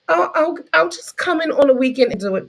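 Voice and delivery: high mock-agreeable worker voice; eager volunteer. Keen worker offering to come in weekends, mocking.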